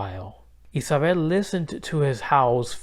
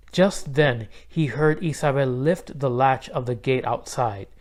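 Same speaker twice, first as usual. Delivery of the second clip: ordinary delivery, nothing marked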